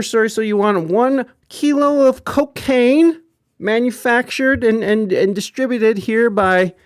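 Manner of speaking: mock naive dealer. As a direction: ham up a clueless dealer reading back orders. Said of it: "You're not fucking sitting there like".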